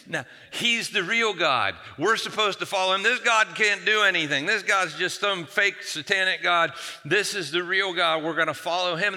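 mildly impassioned urging. Retold or imitated. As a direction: imitate a fired-up prophet driving the point home. Prophet urging the crowd to follow.

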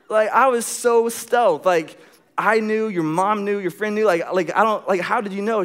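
high-pitched retold disbelief; baffled protest. Man demanding how she found out, baffled. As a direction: protest each point in high bewilderment. Protesting that his surprise was spoiled.

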